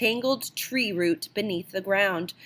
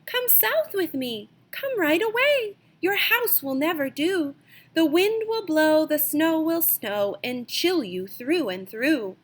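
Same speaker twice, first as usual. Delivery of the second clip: high, sing-song little bird voice; bright bird voice, pitched well up, coaxing the mouse south; phoebe calling; 7 semitones higher than usual. Acted. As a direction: call out like a small bird urging a friend. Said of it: "the phoebe called to Mousekin".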